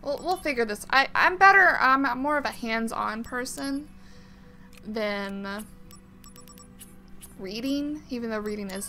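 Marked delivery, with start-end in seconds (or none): none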